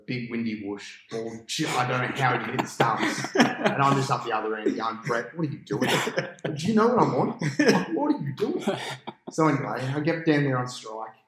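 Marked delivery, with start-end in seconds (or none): 5.0-8.6 loud and much higher, exasperated; mock outraged yell. Batsman yelling at his out partner, shrill outrage. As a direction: yell at him in disbelief.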